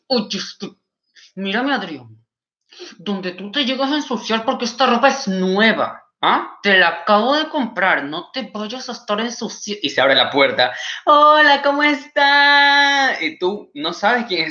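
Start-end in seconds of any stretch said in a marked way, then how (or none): 0.1-9.8 higher, tight-jawed mock mother's threat; imitating a tense, clenched-teeth warning; scolding through clenched teeth. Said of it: "te hablan con, con los dientes metidos". 11.1-13.2 high, loud, drawn-out hello; mock-sweet hostess singsong; gushing party greeting. Mother switches to greet hosts sweetly.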